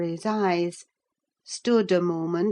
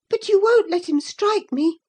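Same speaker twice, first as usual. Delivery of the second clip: high, loud plea; frightened cry; 12 semitones higher, 6 dB louder than usual. A narrator's reading. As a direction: cry out for help. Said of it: "she cried".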